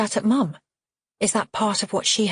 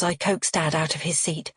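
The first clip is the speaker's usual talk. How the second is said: usual speaking voice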